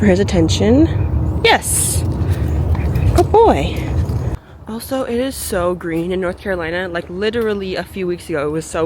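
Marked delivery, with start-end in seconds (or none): 1.4-3.8 high, sing-song praise; dog praise voice. Real, not acted.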